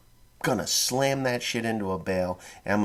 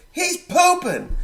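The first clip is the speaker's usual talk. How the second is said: high, louder, slow shout; slow high shouted alarm; drawn-out yell; 10 dB louder, much slower than usual. Retold or imitated. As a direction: stretch it out across the house. Yelling to wife about toddler.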